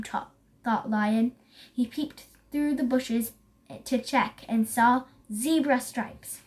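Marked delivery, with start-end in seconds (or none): none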